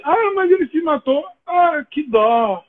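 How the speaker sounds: high, slow, careless sigh of pity; mocking someone who no longer cares; mock indifference. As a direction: shrug it off in a dismissive voice.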